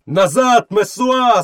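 high mob cry; crowd roaring. A narrator's reading. Crowd ordering the woman back, roaring. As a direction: call her back in a high voice. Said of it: "заревів натовп".

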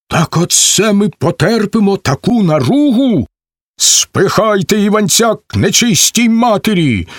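high, indignant rallying cry; angry call to arms. Acted. Shram urges the men against Ivanets, outraged shout. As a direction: stir up the crowd with outrage.

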